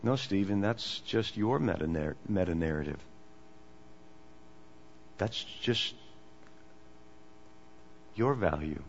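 soft, low, gentle put-down; kindly. Retold or imitated. Postmodern philosophers answering Steven at the conference, kindly. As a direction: correct him kindly, low and unhurried, with pauses.

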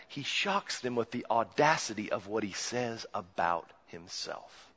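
normal talking voice

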